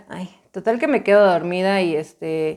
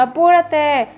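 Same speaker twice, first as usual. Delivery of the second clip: higher, louder mother's hurry-up; mom hurrying her; 8 semitones higher, 4 dB louder, much slower than usual. Retold or imitated. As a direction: play the mother rushing her daughter out. Mamá la apura para la escuela. (mamá apurando a su hija para la escuela, tono más agudo)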